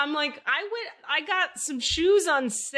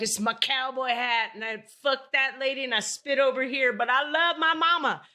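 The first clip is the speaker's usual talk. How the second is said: mock Southern accent. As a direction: put on a Southern cowboy voice. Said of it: "they don't have a Southern accent".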